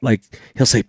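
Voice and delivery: normal talking voice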